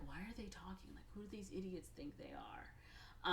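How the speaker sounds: hushed, scornful whisper; a scornful aside whispered to himself; whispered sneer. Retold or imitated. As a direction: mutter it under your breath with contempt. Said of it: "Marshall's like".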